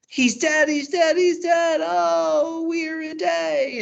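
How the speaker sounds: very high, loud mock wail; theatrical high-pitched lament; hammed-up crying. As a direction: wail like a hysterical nurse bringing news of a death. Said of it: "she's crying and she's like".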